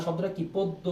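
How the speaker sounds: usual speaking voice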